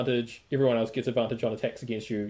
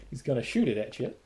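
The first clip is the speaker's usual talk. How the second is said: usual speaking voice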